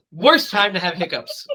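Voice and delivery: ordinary talk, nothing marked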